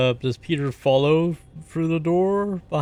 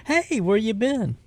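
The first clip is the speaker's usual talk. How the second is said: high, lilting in-character hello; sing-song welcome at the door; playful greeting; 9 semitones higher than usual. Acted. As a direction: greet an old pal with a big pitch lift. Cheery greeting after opening door.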